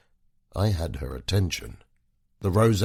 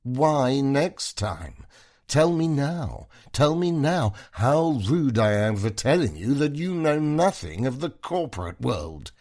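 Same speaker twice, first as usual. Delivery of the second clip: put-on slurred drunk; 8 semitones higher, 4 dB louder than usual. A narrator's reading. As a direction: slur it like tipsy and upset. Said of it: "her voice slurred now".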